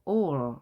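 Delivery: "all" ending on a held L; final dark L. Shown as a demonstration. Teacher shows word-final L, "all" closing on held L. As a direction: finish "all" with the L left in place. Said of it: "입술이 옆으로 길게 된 상태에서".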